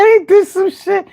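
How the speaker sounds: high, mock-stunned voice; imitating stoned amazement. Recalling his first high to a friend, mock-stunned and high. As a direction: act out the dazed discovery. Retold own reaction.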